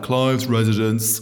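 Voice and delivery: slow mock-posh phone answer; posh accent. Boss answers his house landline, mock-posh accent. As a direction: put on a posh accent, drawn out. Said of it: "so posh".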